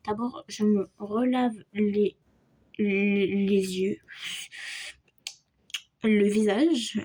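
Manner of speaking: ordinary delivery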